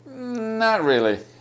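a drawn-out, hesitant native Not really. Shown as a demonstration. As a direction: hold back on Not as if reluctant to disagree. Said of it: "ちょっと躊躇してますね".

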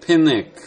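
ペネック with no k at all, loud; ペネック, the k sounds fully gone; k dropped. Shown as a demonstration. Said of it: "消してしまってやると、".

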